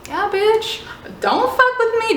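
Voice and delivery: high, cocky triumphant jeer; sassy taunt. Retold or imitated. Girl taunting retreating stalkers, sassy and high. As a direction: gloat as they walk away.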